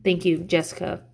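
ordinary talk, nothing marked

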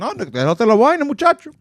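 put-on loud, high Dominican accent; a Dominican man on the phone. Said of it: "the Dominican guy was talking".

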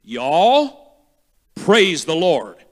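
a light Southern drawl on Y'all; loud Southern command, Y'all stretched; a hint of a Southern preacher; Southern accent. Shown as a demonstration. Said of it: "if I was a Southerner".

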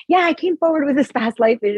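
high, bright, loud mocking voice; mock-chirpy believer. Mocking people who repeat past life talk, in a high loud put-on voice. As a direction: parrot the spiritual crowd with a sneer. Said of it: "And you're like".

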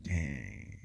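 a low hushed "Dang" of amazement; awed hush. Retold or imitated. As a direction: let "Dang" fall away in quiet awe. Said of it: "I'm like".

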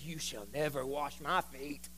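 low, hushed refusal, drawn out; Peter refusing. Acted. Peter says it to Jesus as he refuses, low and hushed. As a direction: refuse slowly and very softly, well down in pitch. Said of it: "Peter says".

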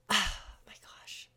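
breathy whispered my gosh; exasperated whisper; whispered sigh. Real, not acted. Sighing before awkward story.

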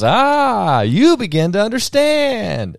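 high, slow, sage mouse voice; a squeaky mouse handing down wisdom; wise cartoon mouse. To Pajama Sam, cryptic clown mouse, high and slow. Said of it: "And then the rat goes".

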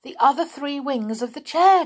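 high, loud cry of dismay; loud, high-pitched horrified exclamation. A narrator's reading. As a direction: cry out aghast at the discovery. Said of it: "groaned Binky".

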